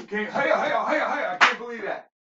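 high-pitched, breathless laughter through the words; laughing hard. Real, not acted. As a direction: cackle helplessly while talking. Overcome with laughter.

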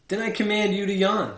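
booming royal order; loud command. Acted. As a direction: command it grandly. King commanding.

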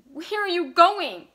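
loud, high, drawn-out demand; agitated shout. A narrator's reading. Vicky following Bert to the door, agitated and loud. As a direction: cry it after him, pitched high.

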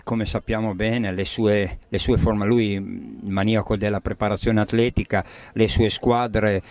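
ordinary talk, nothing marked

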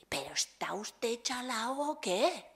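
slow, disbelieving retort; a rough local man asking if he's crazy; incredulous refusal. Retold or imitated. Man from the Alpujarras scoffing at the teacher, slow and incredulous. Angry villager refuses odd request.

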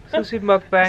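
forced cheer on the words; fake excited. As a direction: act thrilled but unconvincingly. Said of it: "Sounded really fake excited about that".

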